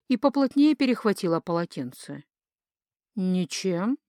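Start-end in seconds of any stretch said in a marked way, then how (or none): 3.1-4.0 said evenly, giving nothing away; level, understated reply. A narrator's reading. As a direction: keep the answer composed and neutral. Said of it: "ровно сказала она".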